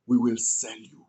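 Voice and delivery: low, hushed and conspiratorial; scheming whisper. Acted. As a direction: murmur it like a secret plan. Brothers decide to sell Joseph.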